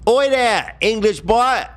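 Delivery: very high, hammed-up British call; squeaky put-on British hail; mock British accent. Mock-calls out to an English boy.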